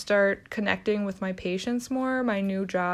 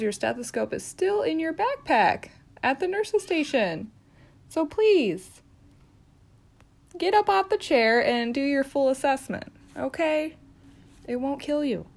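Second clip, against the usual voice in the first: exasperated scolding; 6 semitones higher than usual. Acted. Nurse nagging lazy coworker Linda, exasperated and high. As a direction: nag her out of the chair.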